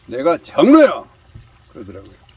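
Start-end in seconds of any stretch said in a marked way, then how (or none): 0.1-1.0 loud indignant yell, chest-thumping pride; angry shout. Retold or imitated. Elder shouting at the pastor, furious. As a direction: bellow it like an insulted elder. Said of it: "장로님이 화가 노발대발".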